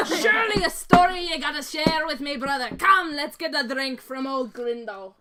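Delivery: loud, lightly Scottish-sounding dwarf voice; hearty dwarf. Acted. Dwarf urging his brother to share the tale over drinks, hearty. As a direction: invite a brother along boisterously.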